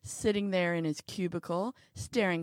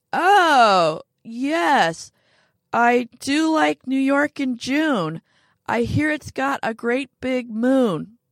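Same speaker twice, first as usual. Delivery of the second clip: fake phone chatter; 6 semitones higher, 10 dB louder, slower than usual. A narrator's reading. Pretending to be on phone.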